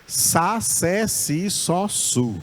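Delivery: Sa, sé, si, só, su with a plain S sound, no P; S syllables. Shown as a demonstration. Teacher modelling Greek psi as plain S syllables. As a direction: drill the syllables with a clean S. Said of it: "vai ser igual ao do S".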